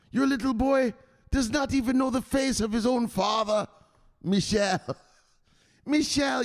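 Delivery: high, begging wife voice; mock wife's plea. Streamer mimics the wife pleading with Michelle to come up, high voice. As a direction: plead with him like a wife who misses him.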